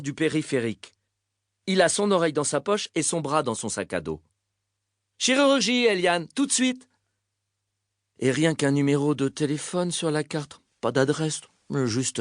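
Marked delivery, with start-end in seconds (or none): 5.2-6.9 raised-pitch urgent summons across a ward; urgent call. Acted. Doctor sending a patient straight to surgery, urgent call. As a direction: call out higher, like an order to the nurse.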